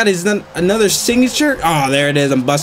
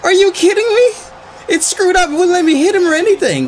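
exasperated complaint; 10 semitones higher than usual. Real, not acted. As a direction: protest the game's unfairness. Game failed to register his input.

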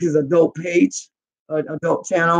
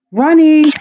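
high, loud call of dismay; loud anguished call; 8 semitones higher, 8 dB louder than usual. Real, not acted. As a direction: cry the name out in panic. Calls out as the stream dies.

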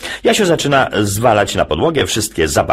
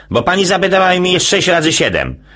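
put-on quick, higher boy's voice; fast high child voice; 12 semitones higher than usual. Jasiu explaining to dad, fast high child voice. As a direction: rattle it off like a kid.

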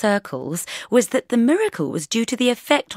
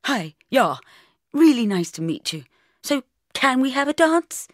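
lightly voiced keen youth, rising higher; eager schoolboy; 6 semitones higher, 5 dB louder than usual. A narrator's reading. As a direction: play a keen, awkward boy asking to dance. Schoolboy nervously asks for a dance.

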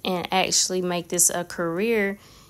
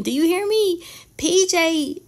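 excited emphasis; 9 semitones higher than usual. Real, not acted. Hyped praise of a friend.

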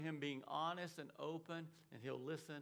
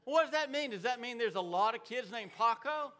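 high and loud, hammer the question home; loud rhetorical question; 5 semitones higher, 8 dB louder than usual. Real, not acted. Preacher challenging the congregation with a loud, high question. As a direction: throw it out to the whole room.

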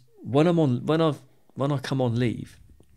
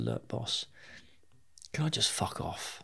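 hushed request; 10 dB quieter, slower than usual. Retold or imitated. Asking the boss quietly for leave.

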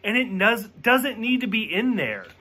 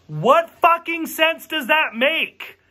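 a real angry rant line, higher and slower; angry outburst; 8 semitones higher, slower than usual. Real, not acted. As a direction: vent frustration at a bad movie. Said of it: "I'm so angry at this".

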